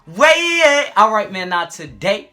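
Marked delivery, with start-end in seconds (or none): 0.0-0.9 high, loud, stretched-out announcer opening; show intro shout. Acted. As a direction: belt out the intro, holding the first and last words.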